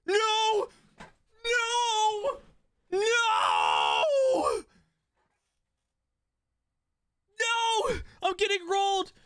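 rising from a pleading no to a cracking, crying shout; desperate no. Real, not acted. Losing the game, pleading.

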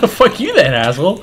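high, loud laughing; laughing insult. Real, not acted. Mock insult after the brush-off.

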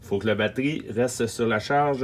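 ordinary talk

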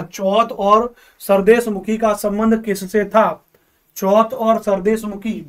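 सवाल दोबारा धीरे-धीरे दोहराना; slow question reread. Shown as a demonstration. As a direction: spell the question out slowly for the class. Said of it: "अगला देखो कितना बढ़िया सवाल है". (धीमी, जमाकर दोहराई गई पंक्ति)

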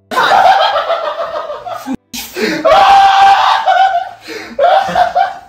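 long screaming laugh. Real, not acted. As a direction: shriek with laughter. Laughing hard at the prank.

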